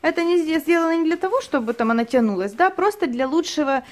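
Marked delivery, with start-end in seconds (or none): none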